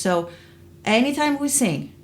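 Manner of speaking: ordinary talk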